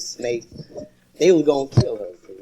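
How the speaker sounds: ordinary delivery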